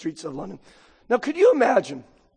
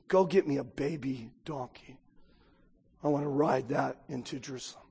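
lower, softer and unhurried; reverent quote; 5 semitones lower, 9 dB quieter than usual. Acted. Preacher quoting Jesus's humble request, lower and softer. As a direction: say it with reverence for the king. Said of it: "Jesus is the King of Kings".

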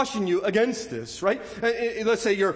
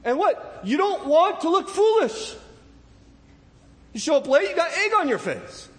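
rising fervor; 7 semitones higher than usual. Real, not acted. Preacher building intensity.

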